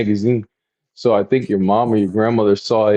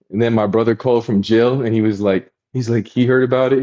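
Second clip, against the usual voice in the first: ordinary talk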